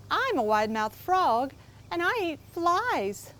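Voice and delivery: proud little frog, lightly voiced; a hint of a cheerful boasting frog. Frog character voice in story.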